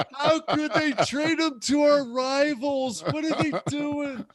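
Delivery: high, slow mock sobbing of a child; imitating crying kid. Ten-year-old hears his team traded their quarterback, imitated high tearful wail. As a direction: play the heartbroken ten-year-old through tears. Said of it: "This kid starts crying".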